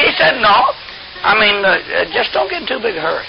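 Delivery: ordinary delivery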